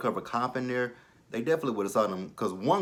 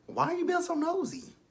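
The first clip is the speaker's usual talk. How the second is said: much higher, mocking question; sassy put-down. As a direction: squeak it out in mock annoyance. Talking back to a character.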